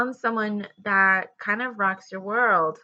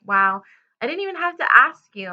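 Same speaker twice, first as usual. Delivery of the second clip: quick, higher, louder mock amazement; acted shock; 4 semitones higher, 7 dB louder than usual. Voicing a partner shocked at unasked help, mock surprise. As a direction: play someone pleasantly shocked by a favor. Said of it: "you're just shocked".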